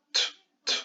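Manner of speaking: "t" as a bare voiceless burst, repeated; son t isolé. Shown as a demonstration. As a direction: sound out "t" twice without a vowel. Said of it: "Ça nous fait un premier son". (son « t » seul, sec, sans voyelle)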